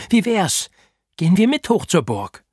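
high, loud eager question; eager pitch. A narrator's reading. Boy urging friends to go to the castle, eager. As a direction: pitch the plan with excitement.

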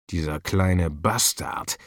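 tief und langsam geknurrter Fluch; verhaltener Fluch. A narrator's reading. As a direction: den Fluch langsam, tief vor sich hin sagen. Bestohlener Boss flucht vor sich hin. (verhalten fluchend)

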